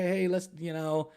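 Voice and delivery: ordinary talk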